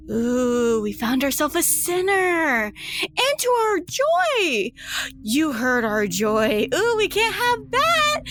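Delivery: gloating sing-song. Acted. Stasia delighting in finding a sinner, high and gleeful. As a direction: savor catching a sinner.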